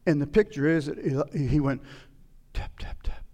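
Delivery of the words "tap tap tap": soft, voiceless little taps; half-hearted tapping. Acted. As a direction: whisper the taps limply. Imitates half-hearted tapping of arrows.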